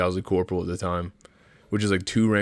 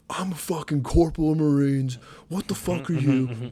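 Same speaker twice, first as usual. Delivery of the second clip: higher-pitched mock of a blustering corporal; self-important corporal; 7 semitones higher than usual. Marine mimicking the corporal he swears he isn't, mock outrage. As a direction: puff up and mock the power-tripper, pitched up. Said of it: "that fucking guy that was like".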